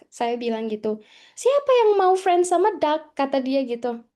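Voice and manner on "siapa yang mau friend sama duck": high, loud mock disbelief; incredulous question. Housemate baffled by friendship with ducks, incredulous retold question. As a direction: act out a baffled retort, pitched up.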